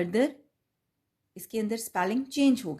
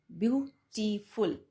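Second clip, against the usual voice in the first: "Beautiful" slowly, one syllable at a time; three separate syllables; slower than usual. Shown as a demonstration. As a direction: count out "beautiful" in three beats: view, टी, फुल. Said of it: "इसमें three syllables आ गए". ("Beautiful" तीन syllables में, रुक-रुक कर)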